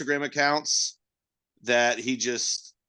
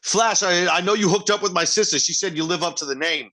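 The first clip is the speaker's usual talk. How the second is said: higher, louder protective brother; mock wiseguy warning; 6 semitones higher, 4 dB louder than usual. Italian brother confronts Flash over sister, mock tough. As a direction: lean on Flash about his sister.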